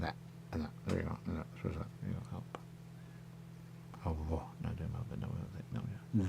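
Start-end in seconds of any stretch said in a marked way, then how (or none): none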